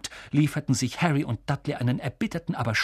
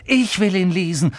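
loud, higher-pitched insistence; angry; 5 semitones higher, 5 dB louder than usual. Acted. Boy fighting for his letter, angry and loud. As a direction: push back with real indignation. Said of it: "sagte Harry wütend".

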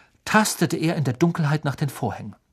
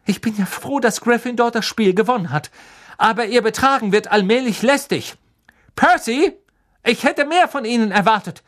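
high, loud stern scolding; cross; 9 semitones higher, 5 dB louder than usual. A narrator's reading. Professor McGonagall scolding the students, loud and high. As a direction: scold the whole house sternly. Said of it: "blickte wütend in die Runde".

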